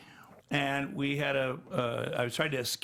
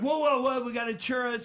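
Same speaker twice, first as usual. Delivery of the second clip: high, urgent mock panic; alarmed objection; 8 semitones higher than usual. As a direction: imitate producers putting the brakes on. Retold alarmed objection from producers.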